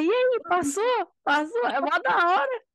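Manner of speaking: high, excited crowd voice, imitated; eager questioning. People waiting outside the consulate asking who passed, excited imitation.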